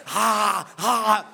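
drawn-out mock possessed shout; spewing open-throated demon noises; acted possessed "ha"; imitated demon cries. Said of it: "demon juice is going everywhere".

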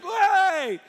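high, loud cheering yell; group cheer. Retold or imitated. The whole team answers the light with a loud high cheer. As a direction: cheer along with the whole rowdy room. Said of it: "And everyone's like".

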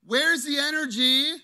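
husband in a skit, mildly put out; offended husband. Acted. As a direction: whine it like a slighted husband. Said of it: "'Cause he's like".

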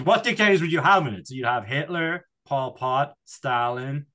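ordinary talk, nothing marked

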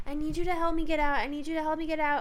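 mock desperate pleading. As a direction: imitate someone begging dramatically for emotional rescue. Mimicking a dramatic complainer's pleas.

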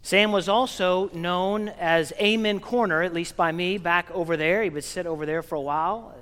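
ordinary delivery

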